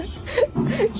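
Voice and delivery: high-pitched giggle; real burst of high giggling; amused laugh. Real, not acted. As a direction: laugh at your own slip. Laughs at missing the clip.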